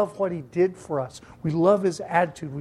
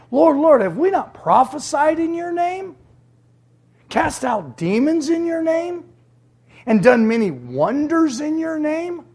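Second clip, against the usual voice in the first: higher, pleading cry of rejected followers; pleading false followers; 9 semitones higher, 3 dB louder than usual. Retold or imitated. Preacher voicing false followers pleading with the Lord, pleading. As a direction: beg like people sure they earned heaven. Pleading crowd voiced by Jesus.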